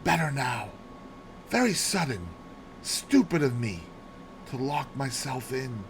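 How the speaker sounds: soft, broken gasps between the words; a sick old lady getting words out between gasps; faint gasping speech after a fit; speaking in gasps. Acted. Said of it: "able to speak in short gasps".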